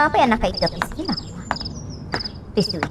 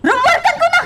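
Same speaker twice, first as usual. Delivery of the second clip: furious shout; 5 dB louder than usual. Acted. Boss firing employee.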